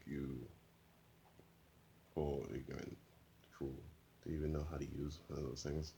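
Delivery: soft, contemptuous villain voice; sneering villain. Acted. The man from the chair mocking Lobo, soft and scornful.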